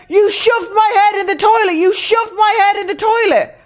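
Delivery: high, loud wail of accusation; imitated screaming. Drunk host accusing helper over the toilet, screaming. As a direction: hammer out the accusation as a mock scream. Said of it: "everyone heard us screaming again".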